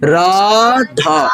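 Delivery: Radha with both long vowels greatly stretched; slow, sustained Radha, vowels pulled right out; drawn-out vowel. Shown as a demonstration. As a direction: hold the आ sounds in Radha very long. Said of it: "लंबा खींचना है".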